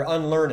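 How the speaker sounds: "unlearned" with the -ed sounded; archaic pronunciation. Shown as a demonstration. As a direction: say "unlearned" as three syllables, un-learn-ed. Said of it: "the reason I'm saying learned".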